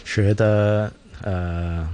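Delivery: usual speaking voice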